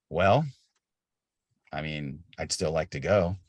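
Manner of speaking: imitating an unfazed, casual medic; retold offhand reluctance to quit; laid-back medic. Retells laid-back medic leaning on van.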